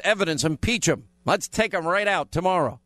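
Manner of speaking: ordinary talk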